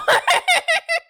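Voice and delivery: very high, loud burst of laughter; delighted laughing shriek. Real, not acted. Laugh after the story.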